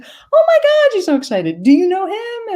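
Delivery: very high, thrilled squeal; excited high old lady. Retold or imitated. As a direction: squeal it out as if overjoyed. Woman more excited.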